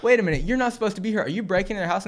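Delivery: high, loud and fast; excited accusation. Acted. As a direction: rush out the accusation. Kid accuses the burglar.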